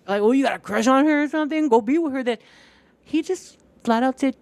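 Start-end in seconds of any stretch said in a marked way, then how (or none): 0.2-2.4 high, quick teasing jab; playful mock challenge in a raised voice; joking. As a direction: retell a teasing dig, pitched up. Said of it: "Like, joking".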